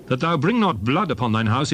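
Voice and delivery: ordinary delivery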